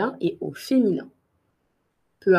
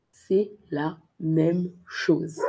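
slow and clear C'est la même chose; slow model; 2 semitones lower, slower than usual. Shown as a demonstration. Teacher repeating slowly for learners. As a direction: draw it out slowly.

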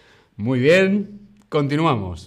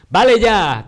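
angry; 8 semitones higher, 7 dB louder than usual. Acted. Acting angry at partying neighbour.

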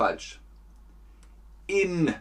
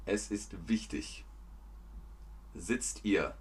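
ordinary talk

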